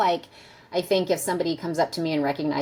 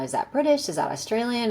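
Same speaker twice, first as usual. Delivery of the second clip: higher-pitched puzzled questions to oneself; retold inner guessing, pitched up; wondering; 7 semitones higher than usual. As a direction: wonder aloud, guessing at the accent. Retold first guesses at an accent.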